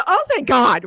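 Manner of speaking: loud, high mock cry of relief; imitated yell. Quoting friend's outburst to the ride worker, mock yell. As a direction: mimic a friend's yelled relief. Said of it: "I just yell out".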